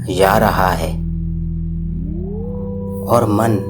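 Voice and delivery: ordinary delivery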